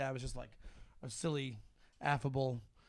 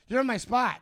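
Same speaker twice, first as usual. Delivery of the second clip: retold challenge, pitched up; confronting dad; 6 semitones higher, 4 dB louder than usual. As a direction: imitate dad claiming his turf. Dad confronts man in his spot.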